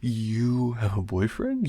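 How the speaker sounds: put-on slow, disbelieving question rising on "boyfriend"; stunned question. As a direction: ask it slowly, taken aback. Stunned by roommate's news of boyfriend.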